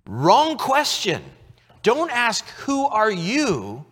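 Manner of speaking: a hint of a quiz host telling off; playful correcting God. God telling Moses he asked wrong, mock quiz host.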